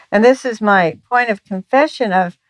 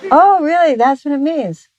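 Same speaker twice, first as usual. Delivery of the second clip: a high, louder, wide-eyed "oh, really?"; mock surprise; 6 semitones higher, 3 dB louder than usual. Husband surprised to learn what the cue means, mock surprise. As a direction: play the husband hearing news.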